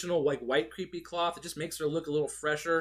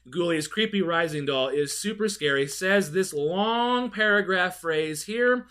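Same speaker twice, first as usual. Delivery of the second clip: ordinary delivery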